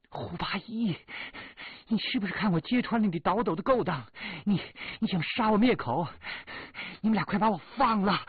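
shrill, high, very loud fury; furious shriek. Acted. Bound Shirley Yang screaming at Hu Bayi, furious and very high. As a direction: scream it through clenched teeth. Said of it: "咬牙切齿地说".

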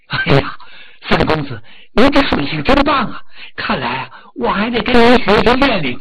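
shrieked hey then loud gushing praise; loud admiring praise. Acted. Steward loudly praising the young master's swimming, gushing. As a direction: gush over him like a fan.

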